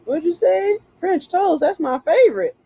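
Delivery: high, loud, excited spirit voice begging for food; hammed-up giddy ghost voice; greedy spirits. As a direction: squeal it like hungry spirits spotting French toast. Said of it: "They be like".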